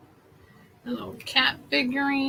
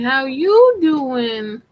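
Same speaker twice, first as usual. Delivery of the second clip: drawn-out pickup line; 7 dB louder, much slower than usual. Retold or imitated. Katie's funny greeting imitated.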